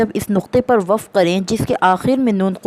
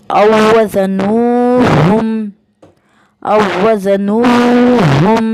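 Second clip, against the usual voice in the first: louder, drawn-out chanted recitation of each example; slow loud recitation; 2 semitones higher, 4 dB louder, much slower than usual. Shown as a demonstration. Teacher reciting examples twice for students, loud slow chant. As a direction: recite each word slowly and firmly, repeating it. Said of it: "वो हम पढ़ेंगे".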